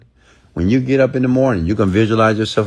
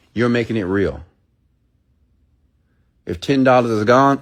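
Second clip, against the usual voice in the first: ordinary talk, nothing marked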